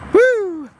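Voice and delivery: high excited whoop; loud playful woo. Real, not acted. Whoop at woman.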